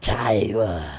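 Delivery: loud, slow, drawn-out creature voice; menacing monster tongue, each word stretched out; creature's threatening speech. Acted. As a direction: pronounce the strange language slowly and forcefully. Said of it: "it's like".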